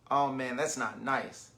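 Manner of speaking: usual speaking voice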